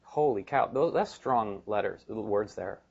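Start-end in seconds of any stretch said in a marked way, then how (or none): none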